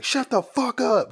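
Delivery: a cop with his gun drawn silencing a suspect; barking cop. Retold or imitated. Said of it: "The dude's like".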